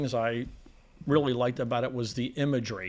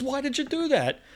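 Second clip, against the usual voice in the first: pitched-up, shocked why; high shocked question; 11 semitones higher than usual. Retold or imitated. High incredulous demand. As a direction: Yossarian demands an answer from the killer, pitched up. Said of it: "He goes".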